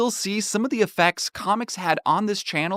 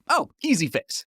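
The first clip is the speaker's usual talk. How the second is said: breezy quip; 7 semitones higher than usual. Retold or imitated. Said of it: "in two seconds I went".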